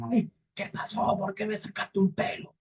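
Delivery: high, strained devil voice; devil crying out. Acted. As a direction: complain like a rudely woken devil. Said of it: "Y el diablo grita".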